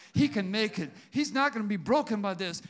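ordinary talk

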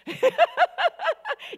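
short high laugh at her own slip; embarrassed laugh. Real, not acted.

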